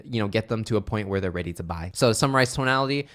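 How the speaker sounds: ordinary delivery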